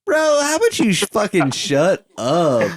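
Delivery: hammed-up outrage, pitch jumping way up; mock angry outburst. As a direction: jokingly yell at your friend to shut up. Mock outburst at co-host's teasing.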